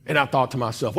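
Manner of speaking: usual speaking voice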